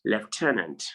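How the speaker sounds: lieutenant as lef-TEN-ant; F before the T in lieutenant; correct pronunciation. Shown as a demonstration. Teacher confirming for students, lieutenant as lef-TEN-ant. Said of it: "it is pronounced".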